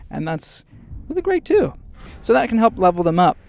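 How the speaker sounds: ordinary talk, nothing marked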